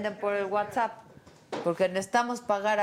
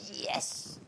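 whispered yes; 7 semitones lower, 10 dB quieter than usual. Retold or imitated. Retold own reaction, whispered.

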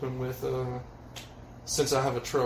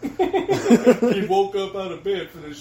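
laughing; 6 semitones higher, 8 dB louder, slower than usual. Real, not acted. Laughing while mocking the friend.

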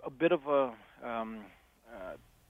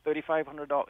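crying voice; 5 semitones higher than usual. Retold or imitated. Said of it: "crying voice".

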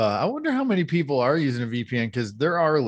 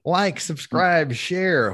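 playful sign-off; 8 semitones higher, much slower than usual. Real, not acted. Host's playful sign-off plea.

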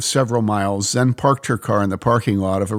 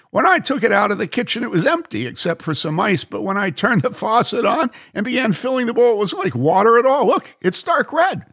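much higher and tumbling faster; excited; 12 semitones higher than usual. A narrator's reading. Bob explains to the lady how the water turned, excited. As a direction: rush it out, amazed. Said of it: "he said in an excited voice".

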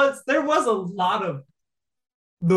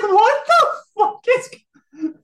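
high, slow disbelief through laughter; can't believe it, half laughing; incredulous; 14 semitones higher, 5 dB louder, slower than usual. Retold or imitated. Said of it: "I'm like".

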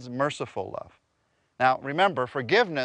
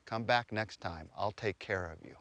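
quiet reassurance; 4 semitones lower, 8 dB quieter than usual. Retold or imitated. Costumed Merlin leans over to a crying boy, quiet reassurance. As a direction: lean in and reassure softly, imitating a costumed performer.

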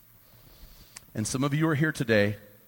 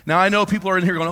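normal talking voice

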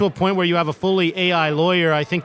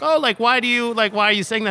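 higher-pitched, mildly puzzled retold question; retold puzzlement; 6 semitones higher than usual. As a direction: lift the pitch, imitating a baffled reaction. Retells founders' puzzled question to investor.

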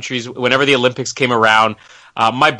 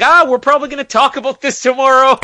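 mock self quote; 13 semitones higher, 4 dB louder than usual. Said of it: "I'm like".

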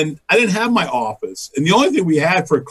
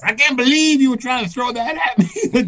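high, exasperated mock scolding; indignant wife; 10 semitones higher than usual. Wife accuses husband of pranking her, high indignant scolding. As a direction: pitch it way up in outrage.

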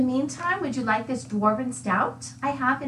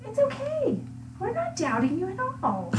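high, patronizing sweetness; mock reassuring; 11 semitones higher than usual. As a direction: soothe him condescendingly.

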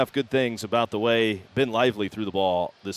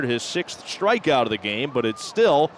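ordinary delivery

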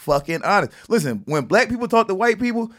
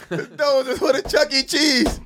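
laughing; 6 semitones higher, slower than usual. Retold or imitated. Repeating the punchline.

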